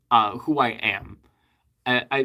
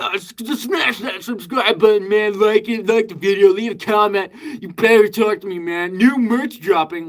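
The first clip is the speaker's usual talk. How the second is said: high, loud, shouty streamer hype; over-the-top hyper channel intro, yelling; hype YouTuber voice; 9 semitones higher, 5 dB louder than usual. Pretend. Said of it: "I'm not, like".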